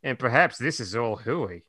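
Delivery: low muttering aside; muttered. Acted. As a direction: mutter it under your breath. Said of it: "Chris muttered".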